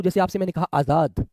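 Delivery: usual speaking voice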